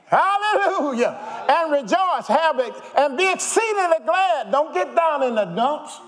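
high, loud, slow hallelujah; joyful preaching shout. Acted. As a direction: rouse the congregation to rejoice.

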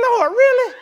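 high, loud, laughing lord really; exasperated outcry. Retold or imitated. Boy who dropped peas crying out to God, laughing disbelief.

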